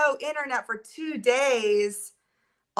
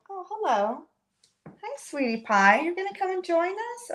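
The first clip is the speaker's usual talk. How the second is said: pet talk; 9 semitones higher than usual. Real, not acted. Talking to her cat.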